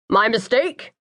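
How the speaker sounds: rough it up, disguising the voice; gruff voice. A narrator's reading. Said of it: "I say in a gruff voice".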